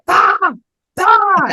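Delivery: put-on short, very high, loud toddler shriek; toddler's gleeful yell. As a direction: imitate a toddler yelling for fun. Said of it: "she's not yelling out of sadness".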